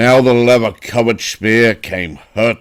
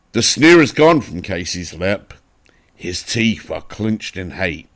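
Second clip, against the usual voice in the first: plain reading voice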